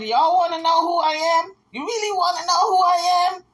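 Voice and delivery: imitating Jaguar's rant, exaggerated; mocking imitation of Jaguar. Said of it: "She said".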